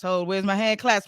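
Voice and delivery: usual speaking voice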